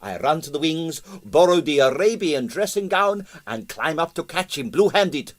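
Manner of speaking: high, lively Russian impresario; excitable Russian impresario; excited retelling. A narrator's reading. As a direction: tell it with outrage. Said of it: "he said indignantly".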